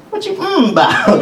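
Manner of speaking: very high comic challenge; mock questioning. Onlooker questioning a moved congregant, high mock query. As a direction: tease as a baffled onlooker. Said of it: "you may hear somebody go".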